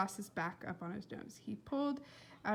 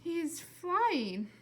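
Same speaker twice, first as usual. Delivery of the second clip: higher, dazed disbelief; strange voice; 8 semitones higher than usual. A narrator's reading. Mother staring at the flying squirrel, strange dazed voice. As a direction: say it as if in a trance. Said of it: "in her strange new voice".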